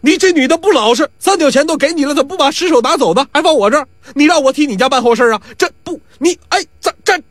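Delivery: high-pitched, agitated outcry; flustered man protesting at high pitch; frantic protest. A narrator's reading. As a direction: call after her in rising panic. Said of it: "这金二胖子急了".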